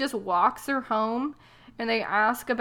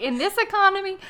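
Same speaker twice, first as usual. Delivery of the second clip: high, louder mock disbelief; incredulous; 10 semitones higher, 4 dB louder, faster than usual. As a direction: toss it up in pitch, scoffing.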